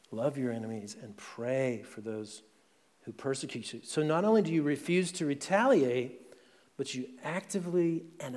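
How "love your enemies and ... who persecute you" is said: softer, reflective repeat of Jesus' words; quiet repetition. Shown as a demonstration. Pastor repeats Jesus' command softly for the congregation to absorb. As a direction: let the words sink in, softly. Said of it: "That's the spirit of the kingdom".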